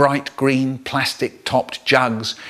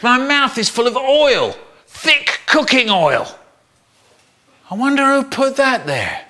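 high, appalled wail; disgusted horror; 9 semitones higher than usual. Acted. Boy with a mouth full of oil, disgusted. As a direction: recoil from a foul mouthful.

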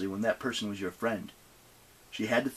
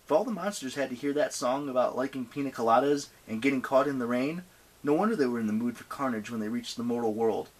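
usual speaking voice

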